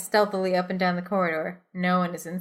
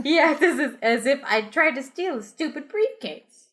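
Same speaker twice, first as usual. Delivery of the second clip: indignant; 7 semitones higher than usual. Acted. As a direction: act offended and huffy. Indignant player voice.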